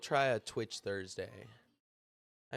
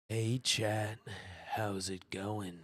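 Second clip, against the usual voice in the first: put-on radio voice; 2 semitones lower, much slower than usual. Streamer greeting chat in a mock silky voice. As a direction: purr it out like you love your own voice. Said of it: "tell me how wonderful my voice is".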